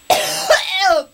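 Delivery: loud hammed-up coughing fit; mock dying cough. Host fakes allergy death for subscriptions, loud hammed-up cough. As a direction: fake a choking cough for laughs. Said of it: "that was me dying from".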